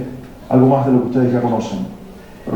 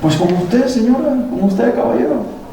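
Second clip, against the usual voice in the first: pointed retort; 10 semitones higher, slower than usual. Retold or imitated. Teacher's retort to parents.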